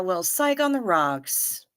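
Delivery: ordinary talk, nothing marked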